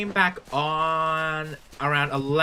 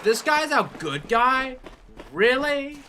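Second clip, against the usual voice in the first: high, slow incredulous quote; scoffing at the hero; mock disbelief; 10 semitones higher than usual. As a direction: sneer it in disbelief, pitched way up. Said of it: "And I'm like".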